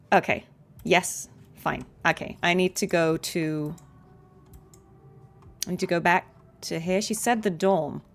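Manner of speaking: usual speaking voice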